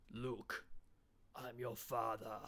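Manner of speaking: slow, drawn-out film villain line; movie villain impression. Comedian quoting a classic movie line, slow and ominous. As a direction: intone it like a classic film quote. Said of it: "classic movie lines being said".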